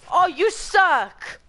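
slow, high and loud jeer; frustrated yell. Real, not acted. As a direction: yell it out at the enemy. Losing a sniper battle.

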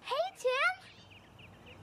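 high-pitched hail; calling out. Acted. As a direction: call to someone at a distance. Calls to boy across park.

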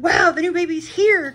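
high, loud, thrilled cry; excited cry. A narrator's reading. Splat hears the gate and cries out that baby's here. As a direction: burst out with excitement. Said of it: "cried Splat".

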